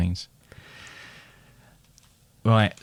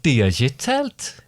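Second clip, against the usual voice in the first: slow Geordie accent; much slower than usual. Shown as a demonstration. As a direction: repeat it slowly in Geordie. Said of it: "get into the character".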